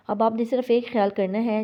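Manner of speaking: ordinary talk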